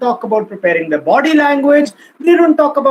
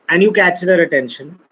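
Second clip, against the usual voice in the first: ordinary talk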